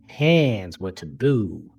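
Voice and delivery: usual speaking voice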